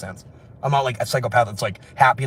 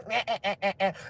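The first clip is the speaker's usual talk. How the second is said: high stuttering bleat; sheep bleat; 7 semitones higher, 9 dB quieter than usual. Acted. Mocking friends at dinner as sheep, high bleating. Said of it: "I can do really good sheep impressions".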